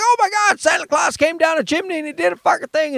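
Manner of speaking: put-on high, fast, thrilled kid voice; imitating kids' excitement. Kids thrilled that Santa came down the chimney, high excited voice. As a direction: gush like a kid on Christmas morning. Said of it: "they're like".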